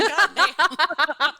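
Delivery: loud laughing exclamation. Real, not acted. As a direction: blurt it out through laughter. Laughing outburst at joke.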